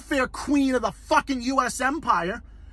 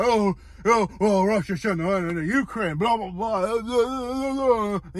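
mocking whine. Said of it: "[name] bitches him".

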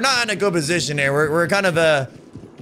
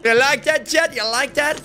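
gloating you like that call-out; taunting; 8 semitones higher than usual. Real, not acted. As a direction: rub it in, gloating. Taunting a downed enemy.

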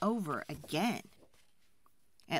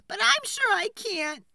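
tearful cry; 7 dB louder than usual. Acted. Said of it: "cried the woodcutter's wife".

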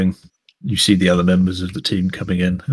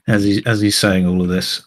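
ordinary talk

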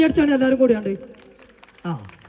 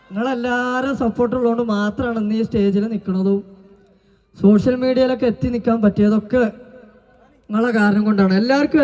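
ordinary talk, nothing marked

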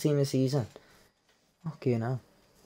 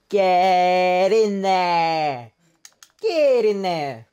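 celebrating; 3 semitones higher, 6 dB louder, much slower than usual. Real, not acted. Celebrating a pulled card.

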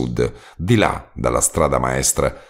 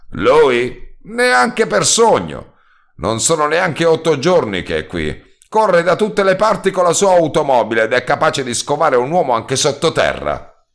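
much higher scoffing cry; scoffing roadman; 10 semitones higher than usual. A narrator's reading. Roadman scoffing to the narrator about the new inspector. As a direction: scoff at the idea.

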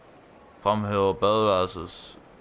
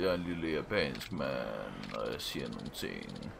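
mock Japanese man; 9 semitones lower, 8 dB quieter than usual.

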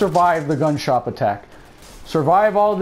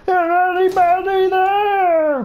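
1 mock calling out; 12 semitones higher, 3 dB louder, much slower than usual. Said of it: "you never yell".

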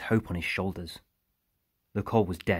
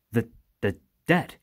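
hesitant question, higher and louder; nervous stammer; 4 semitones higher, 6 dB louder than usual. A narrator's reading. Driver asks his boss about the others, nervously stammering. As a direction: stumble on the first word, voice rising.

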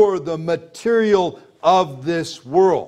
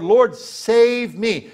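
sinner's plea; 3 semitones higher, much slower than usual. Acted. Voicing a sinner's prayer.

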